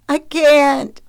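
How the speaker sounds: put-on high, loud little-boy wail; tearful child. A narrator's reading. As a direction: plead like a child about to cry. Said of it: "Milo stammered as he began weeping".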